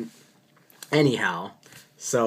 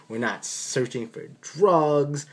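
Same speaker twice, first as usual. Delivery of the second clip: usual speaking voice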